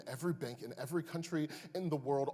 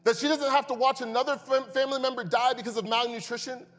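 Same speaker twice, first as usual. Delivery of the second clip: louder, higher impassioned preaching; preaching at full force; 10 semitones higher, 10 dB louder than usual. Acted. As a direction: plead with the church from the pulpit.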